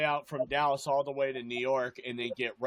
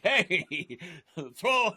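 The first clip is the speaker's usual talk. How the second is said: mock referee call. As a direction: play the flag-happy ref.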